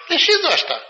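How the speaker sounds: high, loud insistence; high, loud command; insistent order. Retold or imitated. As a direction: press him to give it away. Melania insists again.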